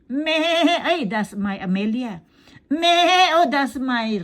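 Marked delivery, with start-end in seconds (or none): 0.0-0.8 higher playful bleat; sheep bleat. Retold or imitated. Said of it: "Kapag ang mga tupa". 2.7-3.4 high loud bleat; sheep bleat. Retold or imitated. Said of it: "Kapag ang mga tupa".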